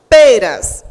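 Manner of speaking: "peras" with a loud, strong first syllable PE; stress on PE. Shown as a demonstration. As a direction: punch the PE of "peras". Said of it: "qual foi a sílaba mais forte". ("peras" com o PE bem forte)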